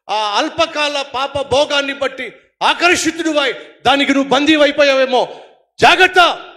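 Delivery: preaching with sudden loud bursts; forceful preaching. Acted. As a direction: exhort the congregation to give up sin. Preacher exhorts the congregation. (బలమైన ప్రసంగ శైలి)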